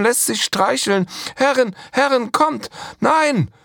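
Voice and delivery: high, loud panicked pleading; frightened cry. Acted. Slave girl crying out to her masters in fear. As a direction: cry out in terror, begging the masters. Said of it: "Mirhalla rief".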